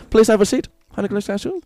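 high-pitched comic German host; mock German accent. Playing host with the cashews, high German accent. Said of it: "in the German accent".

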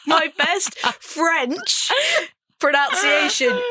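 high, slow, giggly delivery; loud, drawn-out words breaking with laughter; laughing through words. Real, not acted. Still giggling after slip.

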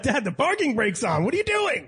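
high-pitched, dragged-out exasperation at dad; exasperated. Retold or imitated. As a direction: re-enact scolding a clueless dad, climbing very high. Acts out exasperated reply to dad.

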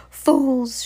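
high loud triumphant crow. Acted. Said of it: "she crowed".

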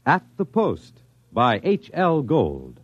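normal talking voice

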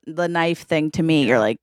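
ordinary talk, nothing marked

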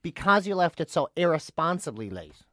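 low, soft, measured airline agent imitation; American airline lady. American airline lady offers late passenger upgrade, measured quote. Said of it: "quote from the American lady".

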